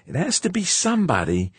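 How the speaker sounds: high, loud character voice, convinced; growing conviction. A narrator's reading. Spaceman concludes there is a spy, growing conviction. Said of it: "said George with growing conviction".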